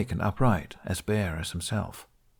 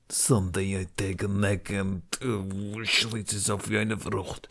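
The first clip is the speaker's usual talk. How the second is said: put-on German accent threat; slower than usual.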